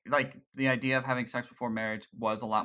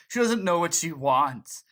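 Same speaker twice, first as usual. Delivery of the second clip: Jacob; 4 semitones higher, 5 dB louder than usual. Retold or imitated. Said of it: "Jacob's like".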